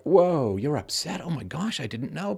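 high, fake-shocked gasping voice; a hammed-up "oh my gosh" reaction; mock surprise. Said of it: "if it's like".

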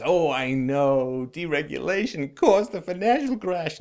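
slow, drawn-out sarcastic echo; mocking objection. Commentator parrots critics of deregulation, mocking objection. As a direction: parody a know-it-all's complaint, dragging the words. Mocks the objection before rebutting it.

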